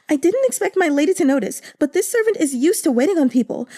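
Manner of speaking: put-on high, quick, eager young maid telling tales; maid's gossiping voice. Yuan Yang explains the ribbon to her lady.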